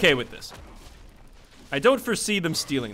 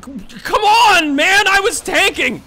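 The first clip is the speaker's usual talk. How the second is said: much higher, louder angry yell; frustrated yelling; 13 semitones higher, 9 dB louder, slower than usual. Real, not acted. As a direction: shout at the teammate who left you. Teammate failed, he yells.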